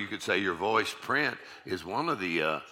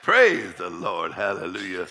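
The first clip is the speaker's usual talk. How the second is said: loud, stretched-out first word; exuberant praise; 7 dB louder than usual. Shown as a demonstration. Preacher acting out praise on a morning stroll, exuberant. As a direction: call out praise, long and punchy. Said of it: "Start off in the morning, go".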